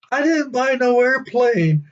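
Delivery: high, slow indignant denial; indignant husband. Retold or imitated. Husband tells the driver he bought no airplane, high and indignant. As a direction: drag out the denial, high and put out.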